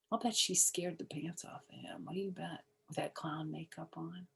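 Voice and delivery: hushed, breathy half-whisper; a soft secret aside. Real, not acted. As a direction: lean in and whisper it to the listener.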